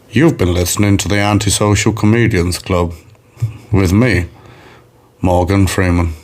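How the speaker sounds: low, measured narrator sign-off; Morgan Freeman. Retold or imitated. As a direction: imitate a solemn film narrator closing the show. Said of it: "do an outro in Morgan Freeman voice".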